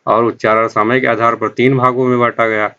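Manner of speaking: normal talking voice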